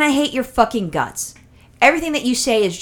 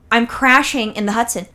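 usual speaking voice

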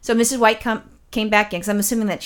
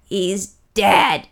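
flat, ominous pronouncement; creepy old lady. Retold or imitated. Old lady tells the roommate, ominous and blunt. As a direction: announce a death like a ghoul. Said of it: "kinda like a horror movie".

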